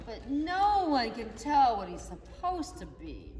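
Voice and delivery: plain reading voice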